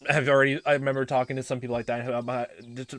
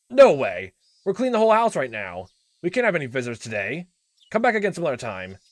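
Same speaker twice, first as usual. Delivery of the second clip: loud, raised, agitated voice; angry refusal; 4 semitones higher, 9 dB louder than usual. Acted. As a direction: shoo off an unwanted caller. Uncle refusing a visit.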